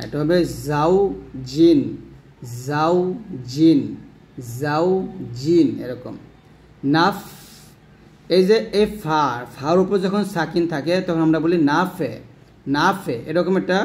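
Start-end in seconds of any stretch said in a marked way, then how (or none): 0.7-5.7 জাউ with z, জিন with j, separated; distinct za and jim. Shown as a demonstration. Teacher contrasting two letters, distinct z and j. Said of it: "ঝা এবং জিমের উচ্চারণ এক হবে না". 11.7-13.2 নাফে with extra e after the f; mistaken echoed ending. Shown as a demonstration. Teacher showing the common mistake, double sound. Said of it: "double শব্দ".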